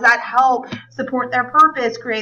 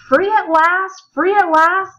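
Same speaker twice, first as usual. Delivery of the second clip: oratory climax; 4 semitones higher than usual. Shown as a demonstration. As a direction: build to the speech's final cry. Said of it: "gives this speech much better than I do".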